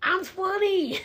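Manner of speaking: high, loud mock dialogue from the clip; TikTok sound quote. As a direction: play both voices of the viral clip. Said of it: "It's like".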